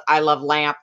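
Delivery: imitated deadpan movie quote. Sister-style quote from Anchorman said back to her, deadpan. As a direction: deliver it deadpan, voice slightly dropped. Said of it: "deadpan expression".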